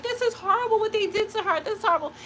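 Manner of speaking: high, sorrowful mock reader voice; mimicking the tarot reader's dismay; imitating tarot reader. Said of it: "they're like".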